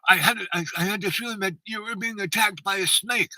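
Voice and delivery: acted-out fretful premonition over the phone; sensitive grandma. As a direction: voice an anxious grandmother. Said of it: "she was kind of very sensitive".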